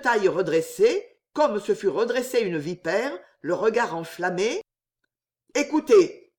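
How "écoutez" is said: loud, higher, abrupt command, mildly breathless; panting, chopped. A narrator's reading. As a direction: demand attention with a touch of urgency. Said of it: "haleta-t-il à mots hachés".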